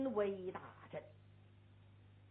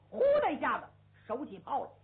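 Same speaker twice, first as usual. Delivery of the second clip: usual speaking voice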